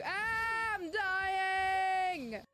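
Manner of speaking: hammed-up crying shout; dying shout. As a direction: wail it like panicked viewers. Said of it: "brought from a very calm, relaxing experience to".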